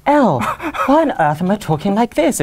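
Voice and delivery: exaggerated accent shift, bewildered at losing the Cockney; non-Cockney accent. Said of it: "he loses his Cockney".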